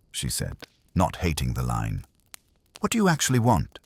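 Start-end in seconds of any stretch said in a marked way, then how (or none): 2.8-3.8 quick, sharp, higher-pitched question; brisk demand. Acted. Secretary pressing the visitor for his real aim, brisk and sharp. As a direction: cut to the point fast.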